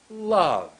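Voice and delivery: LOVE high, loud, long fall; pushed hard. Shown as a demonstration. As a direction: push LOVE out hard and stretch it. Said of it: "Push hard".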